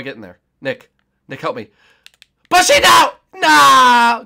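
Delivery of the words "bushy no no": very high and loud; high shrieking no; panicked cry. Real, not acted. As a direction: yelp as the seeker closes in. Being caught, crying out.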